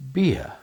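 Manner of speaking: beer said as piə, no r; crisp teacher's beer, vowel gliding to uh; clear beer. Shown as a demonstration. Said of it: "I say".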